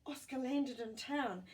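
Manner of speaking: ordinary delivery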